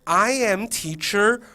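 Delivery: high, slow, stretched-out singsong introduction; crazy excited teacher. Shown as a demonstration. Teacher introducing herself to kindergarteners, exaggerated excitement. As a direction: draw out every word high for little kids. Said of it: "You know, something like that".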